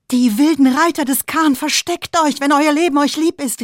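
high, loud alarm cry; shouted warning. A narrator's reading. Father shouts to his family to hide. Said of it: "rief Aslans Vater".

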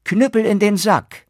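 high, loud command; called out. Acted. Turner calling the cudgel back into the sack, loud command. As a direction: call the cudgel back sharply. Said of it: "rief er".

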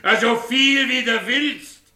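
angry dismissal. Acted. Quarrelling spouse answering a threat to leave, angry dismissal. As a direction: throw it back loudly, drawing out the words.

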